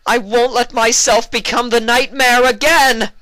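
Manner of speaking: very high, very loud cry; desperate shout. A narrator's reading. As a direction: scream the vow with everything. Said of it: "narrowed her eyes meaningfully".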